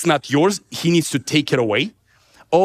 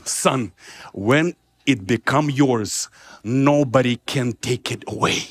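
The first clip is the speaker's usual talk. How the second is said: low, slow voice of Jesus; fatherly teaching; 7 semitones lower, slower than usual. Retold or imitated. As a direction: impart a lesson to a son, slowly. Jesus gently teaching him a lesson.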